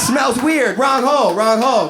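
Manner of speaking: mock alarm. Stoned sperm realize the mistake, loud panicked shout.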